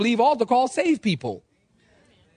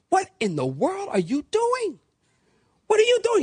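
acted outrage, pitch jumping high; scandalised challenge; 8 semitones higher than usual. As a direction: confront the barbecuer as a shocked, judgemental believer.

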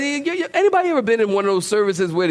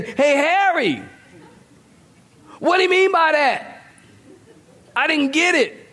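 woman calling to husband; 9 semitones higher than usual. Acted. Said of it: "and she would say".

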